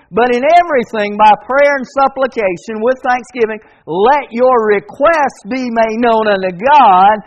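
high, loud revival preaching; fervent preaching. Acted. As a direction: preach it out to the whole room. Preaching at full volume.

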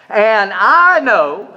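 reading scripture aloud, stressing I; congregational reading aloud. Shown as a demonstration. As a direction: lead the room through the verse. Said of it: "Let's do it again".

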